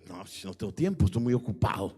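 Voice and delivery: low, loud brush-off from a father; dad too busy to play; busy absent father. Acted. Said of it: "padre presente, ausente".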